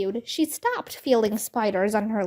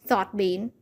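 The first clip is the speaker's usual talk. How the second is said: plain reading voice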